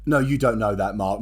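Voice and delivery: usual speaking voice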